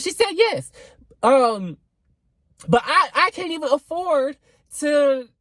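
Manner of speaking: high-pitched, worked-up excitement; too excited. Real, not acted. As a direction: get carried away, pitch jumping high. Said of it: "I'm getting too excited".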